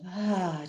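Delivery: dramatic sigh. Shown as a demonstration. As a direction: sigh it out audibly. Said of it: "making that nice sigh of".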